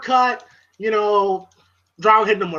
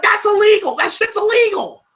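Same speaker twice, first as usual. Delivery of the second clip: high pitched indignant shouting; indignant yell; 11 semitones higher than usual. Retold or imitated. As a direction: shout it at the ref, outraged. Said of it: "I'm like".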